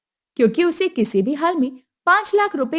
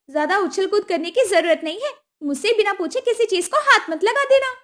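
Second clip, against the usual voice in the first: higher, pushy demand; demanding mother-in-law; 9 semitones higher than usual. Acted. Kusum demanding the dowry at the wedding, high and pushy. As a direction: ask for the money like it's owed.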